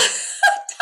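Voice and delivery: very high breathy giggle; high airy laugh. Real, not acted. Delighted by her guides, an airy giggle.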